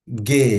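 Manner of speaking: গে said with a hard g, "geh"; teacher introducing the letter গে; Portuguese letter G. Shown as a demonstration. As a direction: model গে firmly. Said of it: "জিটাকে আমরা বলবো হচ্ছে গে".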